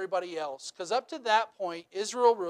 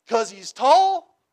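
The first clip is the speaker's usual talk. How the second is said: slow, drawn-out simpleton reply; dopey answer; 4 semitones higher, 9 dB louder, much slower than usual. Retold or imitated. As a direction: say it like it's obvious and enough. People's dumb answer, slow.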